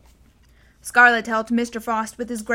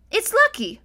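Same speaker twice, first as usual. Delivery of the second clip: Kandar's voice; 11 semitones higher, 4 dB louder than usual. A narrator's reading. Kandar answers.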